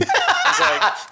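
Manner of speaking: high laughing lead-in. Real, not acted. Telling the knuckle tattoo story, laughing high. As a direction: speak through a high laugh.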